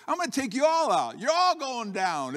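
high-pitched, cocky battle boast; threatening David. Acted. Preacher voicing David threatening the whole army, cocky threat. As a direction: call out the whole crowd with bravado. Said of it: "he's also threatening the whole army".

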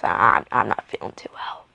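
hoarse whisper from a sore throat. Real, not acted. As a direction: whisper it weakly. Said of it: "because I can barely talk".

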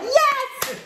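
high, loud, thrilled yes; eager yes. Retold or imitated. The woman agrees to face plant, thrilled and loud. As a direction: cry out a joyful yes. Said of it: "And she's like".

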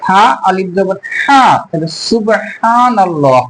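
slow সুবহানাল্লাহ with a throaty, drawn-out হা; hissed s, throaty long হা in সুবহানাল্লাহ, slowly; careful Arabic articulation. Shown as a demonstration. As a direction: stretch the big হা in সুবহানাল্লাহ. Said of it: "এইভাবে আমাদের উচ্চারণ করতে হবে".

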